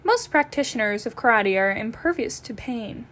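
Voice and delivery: ordinary talk, nothing marked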